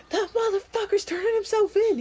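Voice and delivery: very high, loud hallway yell; guard hollering the news to a colleague. Acted. Guards shouting across hallway.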